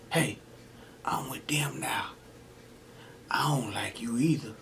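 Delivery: unvoiced low whisper; conspiratorial hush; whispered aside. Tells boss he has switched sides, whispered.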